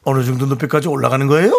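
quick Gangwon dialect, 거예요 rising at the end after a dip mid-phrase. Shown as a demonstration. Host demonstrates Gangwon dialect to co-hosts, quick rising tune. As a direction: Gangwon tune that breaks once midway, then 거예요 climbs. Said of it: "강원도 사투리는".

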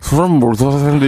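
put-on slurred, blurry drunk speech; town drunk. Shown as a demonstration. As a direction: slur the words like a drunk. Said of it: "이렇게 하면 되니까요".

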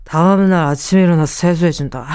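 low, groggy, half-laughing voice; tired morning voice. Shown as a demonstration. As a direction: drag it out like just woken. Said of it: "더 피곤한 목소리를 내볼까?".

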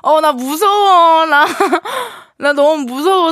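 put-on laughing, whiny drunk voice; acting drunk. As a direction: drunk and giggly. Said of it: "취한 연기하는 게 정말 쉽지".

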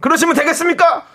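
high, indignant telling-off; mock scolding. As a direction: playfully scold the husband. Telling off a listener's husband. (장난스럽게 따지는 말투)

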